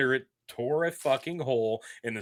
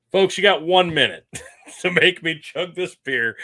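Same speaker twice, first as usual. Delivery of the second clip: laughing; 6 semitones higher, 9 dB louder than usual. Real, not acted. Laughing pitch to viewers.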